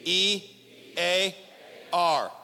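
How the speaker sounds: letters called out loud; call and response shout. Real, not acted. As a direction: shout each letter back with the crowd. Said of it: "Everyone say D".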